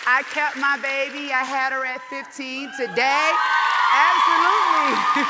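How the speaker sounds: loud, rising declaration to the crowd; proclaiming her daughter's survival, loud and proud; rousing proud shout. Real, not acted. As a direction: belt it out with pride. Triumphant climax of her story.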